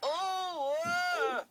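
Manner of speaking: high, loud cry of dismay; loud groan of dismay. Acted. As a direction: howl out the dismay. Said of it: "groaned Percy".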